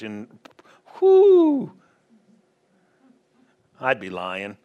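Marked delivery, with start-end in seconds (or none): 1.0-1.7 excited whoo. Real, not acted. Preacher's excited exclamation.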